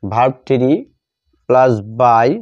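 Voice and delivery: ordinary talk, nothing marked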